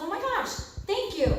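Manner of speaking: high-pitched imitation of stunned thanks; mock astonished, voice lifted high; shocked gratitude. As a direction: act out being amazed and thankful. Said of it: "He was so shocked".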